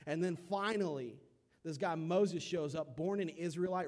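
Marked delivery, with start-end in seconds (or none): none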